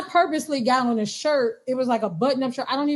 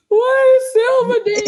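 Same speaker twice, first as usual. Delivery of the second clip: high, loud mock wail; retold bawling; 13 semitones higher, 8 dB louder than usual. Patient reacting to doctor's news of Silvadene, retold bawling. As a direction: wail it out, imitating your own bawling. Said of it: "I just started bawling".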